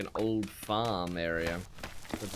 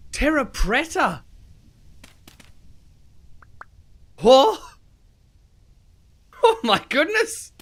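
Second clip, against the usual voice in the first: excited shout; 13 semitones higher, 10 dB louder than usual. Real, not acted. Thrilled find of rare block.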